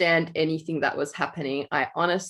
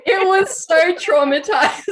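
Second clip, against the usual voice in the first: high, louder, still laughing; 13 semitones higher, 6 dB louder than usual. Real, not acted. Agreeing the Cats movie was awful, laughing. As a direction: laugh it out in mock horror.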